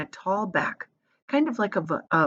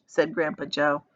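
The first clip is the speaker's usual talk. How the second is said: plain reading voice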